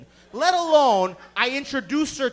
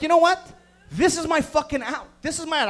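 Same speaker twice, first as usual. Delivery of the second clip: loud, higher, acted indignation; fed-up outburst; 6 semitones higher, 7 dB louder than usual. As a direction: declare you've had enough. Fed up, announcing his exit.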